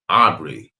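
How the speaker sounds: ordinary delivery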